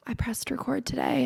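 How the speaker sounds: hushed whisper of the same line. Retold or imitated. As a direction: breathe it like a secret. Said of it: "I'm like".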